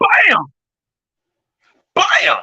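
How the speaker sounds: loud high hype shout; excited shout. Real, not acted. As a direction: shout it out, pumped up. Hyping up the upcoming show.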